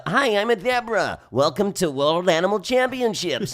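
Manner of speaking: high, loud, drawn-out cartoon zebra; crazy zebra voice. Shown as a demonstration. Voice actor as zebra welcoming viewers, crazy voice. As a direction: squeal it like a zany cartoon animal. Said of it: "I'm doing a crazy".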